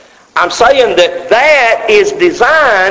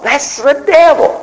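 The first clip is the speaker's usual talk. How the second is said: higher, mock-naive voice of the doubter; mock objector; 8 semitones higher than usual. Preacher voicing a doubting congregant, mock naive. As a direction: play the listener who blames the devil.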